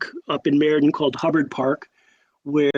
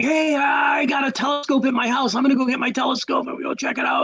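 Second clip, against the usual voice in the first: high put-on eager voice; faked casual bravado; 8 semitones higher than usual. Scared teen hiding fear from his friends, faked bravado. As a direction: imitate a scared kid overselling enthusiasm, high and rushed. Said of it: "I didn't wanna show my friends that I was that scared".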